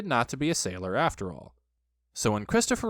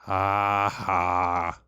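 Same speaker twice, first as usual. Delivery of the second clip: low, mirthless spoken laugh; bitter; 6 semitones lower, 4 dB louder than usual. A narrator's reading. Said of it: "said Eeyore bitterly".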